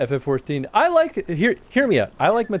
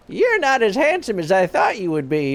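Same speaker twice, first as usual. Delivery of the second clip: put-on old witch voice; 7 semitones higher than usual. Quoting Matoya.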